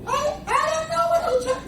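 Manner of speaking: high mock falsetto; imitating a woman. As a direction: put on a very high, feminine voice. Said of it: "they start talking like women".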